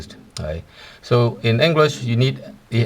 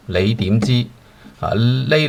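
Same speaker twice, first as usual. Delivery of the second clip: usual speaking voice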